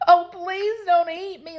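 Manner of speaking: high, loud, drawn-out plea; slow, high-pitched cry for mercy. A narrator's reading. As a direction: plead loudly like a frightened little girl. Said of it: "Leola cried".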